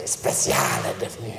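a soft, hushed whisper; a low, unvoiced storyteller's whisper. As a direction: breathe the words to close the tale. Hushed closing line of story.

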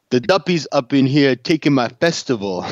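attempted Jamaican accent; a light try at Jamaican patois. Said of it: "never do a Jamaican accent".

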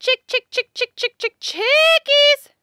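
high, loud sing-song chick call; calling chickens. Real, not acted.

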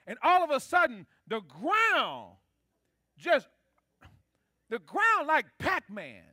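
loud, building preacher's cry; preaching at full force. Real, not acted. Preacher to the church on Korah, loud and fervent. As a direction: preach it out, voice rising and ringing.

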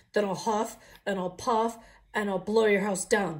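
loud, menacing threat; the pig roaring at the wolves; pig's threat. Acted. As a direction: bellow the threat.

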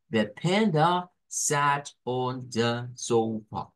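"The panda sat on the sofa" very slow, full words stressed, weak schwas; slow, measured model sentence stressing "panda", "sat" and "sofa"; schwa intonation. Shown as a demonstration. Teacher models the sentence's intonation for learners, slow and stressed. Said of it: "it's going to be your intonation for this".

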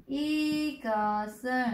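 Y cơ sơ, very slow, final consonant carried into sơ; linked reading. Shown as a demonstration. Teacher slowly demonstrates linked reading of Y cơ sơ. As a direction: slowly link the batchim across into Y cơ sơ. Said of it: "chúng ta đọc luyến bắt chim lên".

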